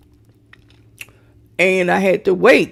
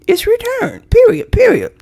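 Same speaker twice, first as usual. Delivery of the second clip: sassy demand; 12 semitones higher than usual. Real, not acted. Demanding money back.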